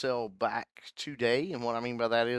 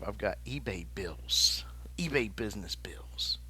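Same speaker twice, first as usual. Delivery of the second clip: whispered; 3 semitones lower, 7 dB quieter than usual. Real, not acted. Voicing money pressure.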